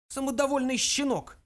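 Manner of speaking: put-on high, indignant elderly insult; old man's high scolding; contemptuous old ghost. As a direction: spit scorn at the youngster. Ghost insults great-grandson.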